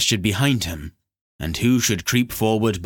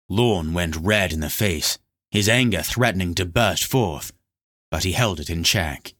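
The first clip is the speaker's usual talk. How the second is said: ordinary delivery, nothing marked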